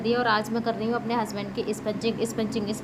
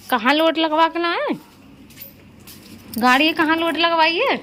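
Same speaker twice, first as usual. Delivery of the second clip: higher, louder mock-scolding; playful teasing; 7 semitones higher, 4 dB louder than usual. Real, not acted. Wife teasing husband over the dirty car, high mock scold. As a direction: tease him about the muddy car.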